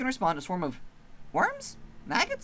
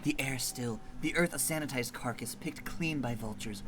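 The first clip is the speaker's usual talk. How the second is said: soft, hushed ominous telling; eerie story narration; 4 semitones lower, 5 dB quieter than usual. A narrator's reading. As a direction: draw them into the dread quietly.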